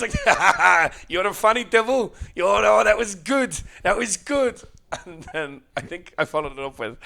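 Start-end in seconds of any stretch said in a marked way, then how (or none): 0.2-4.6 higher, louder delighted praise; delighted old man. Retold or imitated. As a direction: gush over the joke. Imitating man's delighted reaction.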